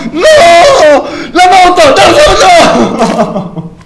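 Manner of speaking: high panicked screams of no; screaming in terror while fleeing; being chased. Real, not acted.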